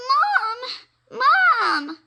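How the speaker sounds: high, loud panicked cry; scream. Acted. Frightened rabbit screaming for his mom. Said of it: "Jasper screamed".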